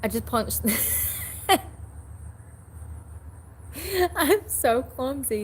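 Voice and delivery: high-pitched, slow, breathless laughter; real tearful laughing at her own clumsiness; laughing to tears. Real, not acted. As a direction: gasp the words out between laughs. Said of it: "I'm literally crying".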